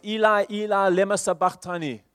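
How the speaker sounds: high anguished cry; loud cry. Acted. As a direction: cry it out to heaven. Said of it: "in a loud voice".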